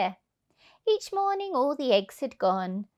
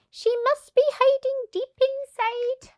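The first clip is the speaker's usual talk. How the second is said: very high, loud sly voice; coy goose; 3 dB louder than usual. Acted. Goose fooling the thieves, very high. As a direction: tease them coyly. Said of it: "the goose replied".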